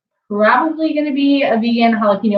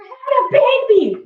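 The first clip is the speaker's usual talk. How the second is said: mock excited announcement; 10 semitones higher than usual.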